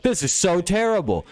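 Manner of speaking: mock whiny complaint. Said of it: "now everybody's like".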